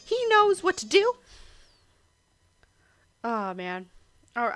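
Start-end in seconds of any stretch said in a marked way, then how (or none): 0.0-1.1 high, louder character voice; animated cartoon hero with a plan; Cuphead, urgent. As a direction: urge your brother on, pitched high. Voices Cuphead reading game story.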